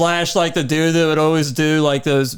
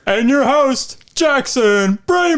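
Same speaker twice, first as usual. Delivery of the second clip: announcer voice; 6 semitones higher, slower than usual. Pretend. Host intro of himself.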